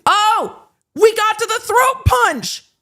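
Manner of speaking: high-pitched, drawn-out excited cry; excited shout. Real, not acted. Host reaching the throat punch part, excited shout. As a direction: shout it with glee, voice leaping up.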